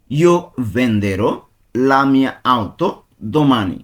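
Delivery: slow, piece-by-piece Italian with pauses; broken into pieces. Shown as a demonstration. Teacher breaking the sentence down so students follow, slowly. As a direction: break it into chunks for learners. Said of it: "আমি ভেঙে ভেঙে বলবো".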